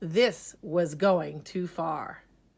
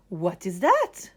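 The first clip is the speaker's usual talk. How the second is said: teacher question; 4 dB louder than usual. Acted. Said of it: "asked the teacher".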